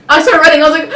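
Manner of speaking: ordinary talk, nothing marked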